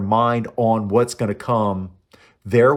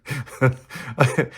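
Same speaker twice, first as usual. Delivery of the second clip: hearty laugh. Real, not acted. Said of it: "So I'm gonna laugh about that".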